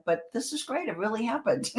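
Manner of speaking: calmly delighted. Retold or imitated. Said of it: "I'm taking a horrible situation going".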